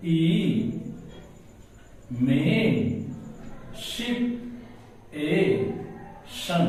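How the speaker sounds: "ई में शिप ए शन" as isolated syllables with long gaps; slow word breakdown, pausing between "ई", "में", "शिप", "ए", "शन". Shown as a demonstration. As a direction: sound out each piece of "ई में शिप ए शन" alone. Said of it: "इसका आप syllable बनाते जाइए".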